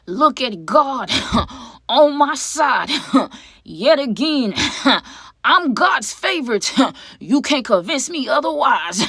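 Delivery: high, loud, drawn-out church whoop with laughing punctuation; mock preacher praise. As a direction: preach it slowly and triumphantly, voice soaring. Mock church praise of own luck.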